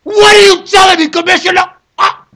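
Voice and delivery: high, loud, furious demand; outraged yell; angry shout. Real, not acted. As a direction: bellow it at the commissioner. Shouting at the commissioner.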